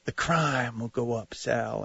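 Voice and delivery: hushed preacher whisper-shout, lightly done; a hint of a preacher, half whispered; Ray Lewis whisper shout. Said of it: "I talk in that whisper shout of the preacher man".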